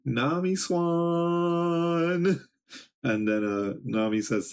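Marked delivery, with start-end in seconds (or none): 0.0-2.4 a long drawn-out, high lovestruck call; swooning shout. Acted. Sanji calling out to Nami, slow high swooning cry. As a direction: stretch it out like a smitten fool.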